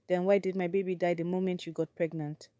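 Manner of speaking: usual speaking voice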